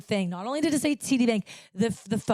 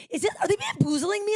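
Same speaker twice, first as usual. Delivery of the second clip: high-pitched incredulous outburst, acted out; retold disbelief; 11 semitones higher than usual. Scam victim asking herself if she's being bamboozled, incredulous. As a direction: squeal it in mock bewilderment.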